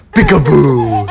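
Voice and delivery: loud playful burst; peekaboo call. Real, not acted. Mother playing peekaboo with baby, loud playful call.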